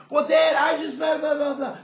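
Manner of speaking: shouted high-pitched back talk; hammed-up kid protesting over his dad; arguing teenager. Teenage son argues back at his dad, protesting.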